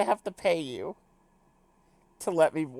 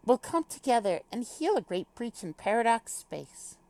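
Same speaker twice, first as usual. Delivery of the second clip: higher, lightly British formal voice; little British accent; 5 semitones higher than usual. Acted. Character foretells healing Paradox space, higher light British accent. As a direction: deliver it grandly with a British lilt. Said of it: "do a little British accent".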